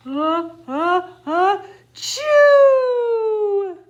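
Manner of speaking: a hammed-up sneeze getting ready to explode; big sneeze. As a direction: build into a loud, high sneeze. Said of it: "He blows as he sneezes".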